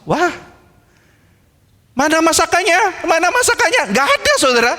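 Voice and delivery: high, shocked cry of where is it; a child staring at an empty table in disbelief; a child's shrill cry that his lunch is gone. Acted. Child finds no cooked food.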